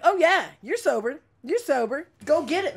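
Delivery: high, mock-supportive jeer; sarcastic encouragement. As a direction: cheer him on without believing a word. Mocking his claim of sobriety.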